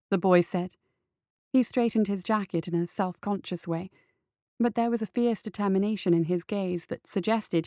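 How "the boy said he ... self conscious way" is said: usual speaking voice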